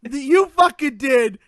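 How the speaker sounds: put-on loud, brash Boston accent. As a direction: punch it out in Boston. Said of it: "I am doing Rosie O'Donnell's Boston accent".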